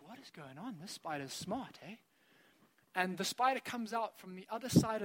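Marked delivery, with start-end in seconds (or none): 0.1-1.9 much softer, half under the breath; hushed disbelief. Retold or imitated. Staring down the spider, quietly amazed at its trick. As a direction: mutter it to yourself, baffled.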